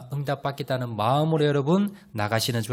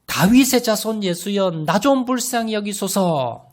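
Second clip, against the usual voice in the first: higher, louder cry for mercy; pleading cry; 6 semitones higher, 4 dB louder than usual. Acted. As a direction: call out, refusing to miss the chance. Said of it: "이 기회를 놓치지 않겠다는 마음으로".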